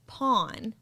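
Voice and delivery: Florida PAWN with open ah vowel; Florida accent. Shown as a demonstration. Correcting her companion's word, Florida accent. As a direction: say PAWN as pahn, Florida style. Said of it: "saying them in a Florida accent".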